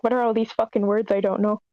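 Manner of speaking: normal talking voice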